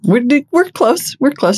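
high, slow singsong tease; high teasing voice. Acted. Teasing build-up to topic.

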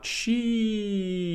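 long, high-pitched sheesh sliding down; drawn-out falsetto-like exclamation; mock hype sheesh. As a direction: stretch it high like a hyped-up commenter. Mock-reading a slang comment.

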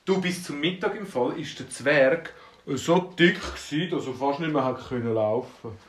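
plain reading voice

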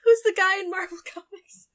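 high, loud, tearful with laughter; real giddy outburst; excited, laughing. Real, not acted. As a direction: blurt it out while cracking up. Excited agreement through laughter.

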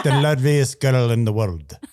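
low Scottish copy of the tutorial; Scottish accent. Acted. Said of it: "How to speak with a Scottish accent".

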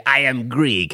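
lightly put-on old Greek man accent; a hint of a fake old Greek man accent. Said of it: "your old Greek voice is so good".

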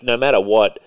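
ordinary talk

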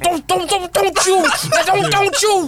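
high, fast stammering take-off; stuttering impression. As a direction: stammer it out in a mock panic. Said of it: "he gonna be over there stuttering".

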